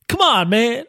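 hammed-up Biden catchphrase, tongue in cheek; Joe Biden imitation. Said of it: "my Joe Biden imitation".

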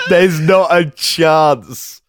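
slow, high, laughing disbelief. Real, not acted. To co-host, laughing that he won't take his own advice.

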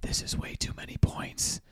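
whispered right into the mic; close whisper. Real, not acted. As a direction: lean in and whisper it. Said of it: "I'm gonna get close to the mic and whisper".

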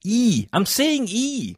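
high-pitched, slow, insistent E; insistently pronounced E. Real, not acted. Scottish presenter correcting colleagues who mishear the letter, insistent E. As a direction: push the E up high and draw it out. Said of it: "I have to pronounce the E".

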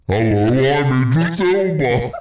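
low, slow, mock manly impression; Idris Elba. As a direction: boom it out deep and drawn-out. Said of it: "he's manly".

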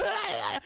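high-pitched flailing cry; flailing yell. Retold or imitated. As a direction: yell like a body flung about. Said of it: "that was me and my body".

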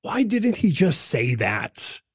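slow, puzzled student voice; lightly voiced puzzled student; imitating students. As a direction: mimic a baffled student. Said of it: "My students always say".

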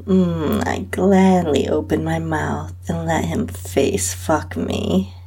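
plain reading voice

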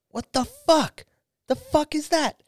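put-on shocked boyfriend. Boyfriend staring at the collapsed trailer, in high mock shock. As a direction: play the guy gaping at his wrecked trailer. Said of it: "he's like".

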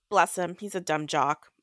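ordinary talk, nothing marked

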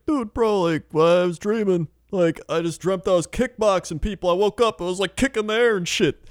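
high hyped bro voice; Joe Rogan impression. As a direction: talk like an excited bro. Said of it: "Joe Rogan's like".

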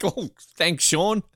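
normal talking voice

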